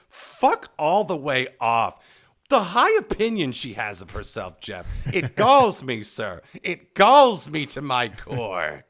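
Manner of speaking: loud, slow, theatrical indignation; hammed-up outrage. Telling Jeff the singer galls him, hammed-up outrage. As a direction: draw out each phrase in mock fury.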